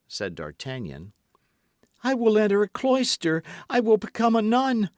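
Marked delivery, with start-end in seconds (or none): 2.0-4.9 very high, loud frantic plea; desperate plea. A narrator's reading. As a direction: beg frantically. Milady pleading.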